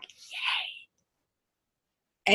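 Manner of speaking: whispered mock yay; hushed cheer. Teacher imagining a friend's yes, whispered cheer. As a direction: cheer under your breath, barely voiced. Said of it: "and you're like".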